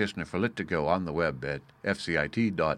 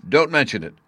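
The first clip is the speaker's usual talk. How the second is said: raised, louder courteous answer; called reply; 6 semitones higher, 8 dB louder than usual. Acted. Officer raises his hat to the departing professor, calling courteously. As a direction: call back a polite dismissal of thanks.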